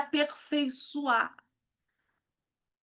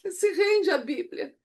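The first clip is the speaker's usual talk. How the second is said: overcome, pushing words out through tears; real tearful awe; moved to tears; 5 semitones higher, 7 dB louder than usual. Real, not acted. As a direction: voice high and choked with emotion. Moved by the Bible, choking up.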